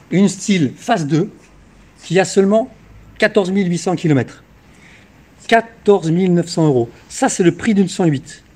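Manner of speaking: loud, punchy sales pitch; infomercial. Acted. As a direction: hype it up like a TV car dealer. Car dealer hard-sell pitch.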